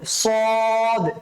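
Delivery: সদ with heavy s and long rounded vowel; thick sad. Shown as a demonstration. Teacher demonstrates the rule for students, heavy সদ. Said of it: "মোটা করে উচ্চারণ করতে হবে".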